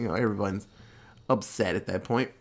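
ordinary talk, nothing marked

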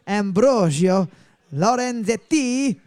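slow hammed-up Italian Ambrogio Lorenzetti; mock Italian accent. Shown as a demonstration. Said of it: "there's a lot of work you need to do on your German".

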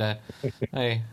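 ordinary talk

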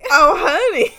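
high, loud hammed-up Southern; exaggerated Southern accent. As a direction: belt out a cartoon Southern accent. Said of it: "legit Southern accent, not, like".

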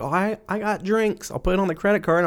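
high-pitched imitation of a spender; mock a friend breezily offering to pay; carefree spender. Imitating free-spending friends in debt.

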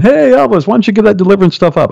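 high-pitched, rapid call-out; heckler across the street. Acted. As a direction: holler it to someone over the road. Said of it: "some guy across the street saying".

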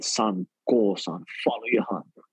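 low, gentle mom; tender blessing. Retold or imitated. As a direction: mom tenderly telling son to follow his heart. Mother now blessing him.

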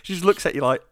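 usual speaking voice

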